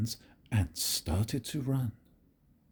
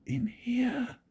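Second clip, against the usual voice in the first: a high, secret whisper; 13 semitones higher than usual. A narrator's reading. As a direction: breathe it so no one wakes. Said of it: "whispered James".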